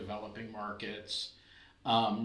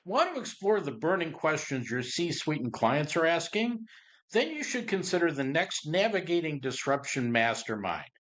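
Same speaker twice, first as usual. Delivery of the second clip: upbeat promo pitch, a touch louder; ad read; 4 semitones higher, 5 dB louder than usual. Acted. Host pitching the next mastermind to the audience, upbeat ad read. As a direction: sell the mastermind to listeners.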